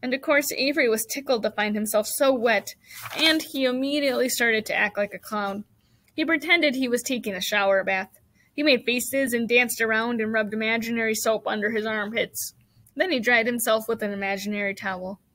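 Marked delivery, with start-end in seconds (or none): none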